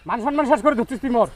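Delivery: normal talking voice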